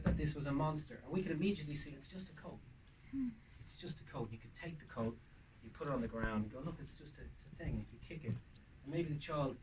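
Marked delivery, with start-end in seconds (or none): 1.9-4.3 put-on hushed, soothing to a scared child; gently calm the child down; soft reassurance. Adult soothing a scared child, hushed and soft. 6.6-7.7 breathy whisper, no voice; whispered reassurance. Pretend. Adult showing the child the coat, whispered.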